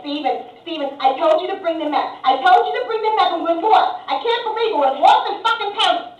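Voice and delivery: loud, high Long Island nagging; Long Island wife scolding at full volume; Long Island accent. Acted. Wife arguing with Steven on the metro, loud Long Island accent. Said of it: "they were talking like this".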